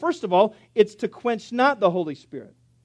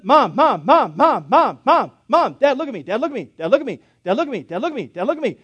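put-on louder, higher insistent child calling; nagging kid; 3 semitones higher, 4 dB louder than usual. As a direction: whine for attention like a needy kid. Son nagging for attention.

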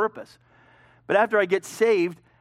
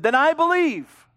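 eager declaration; 10 semitones higher, 6 dB louder, slower than usual. Acted. Eunuch's eager confession of faith.

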